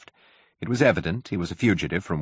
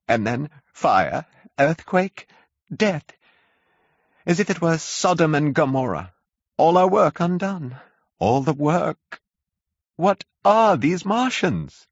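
high, louder, wailing complaint; complaining curate; 10 semitones higher, 3 dB louder than usual. A narrator's reading. Shaken curate complains to the narrator, high and wailing. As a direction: whine it out like a broken man. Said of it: "spoke in almost a complaining tone".

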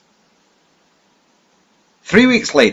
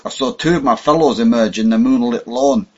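ordinary delivery

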